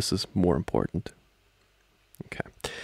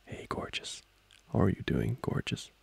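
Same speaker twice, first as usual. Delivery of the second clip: half-whispered, much softer; hushed greeting; 8 dB quieter than usual. Acted. Trying "gorgeous" on a partner, hushed greeting. Murmuring pet name to partner.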